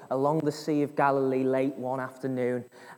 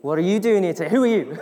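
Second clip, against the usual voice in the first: raised, louder joking demand; hammed-up questioning, building louder; mock challenge; 3 semitones higher, 5 dB louder, faster than usual. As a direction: demand it of your spouse in jest. Joking as spouse repeating the question.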